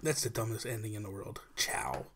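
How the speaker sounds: a hushed teasing jab; whispered mockery. Real, not acted. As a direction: whisper a scornful aside. Mocking the outro.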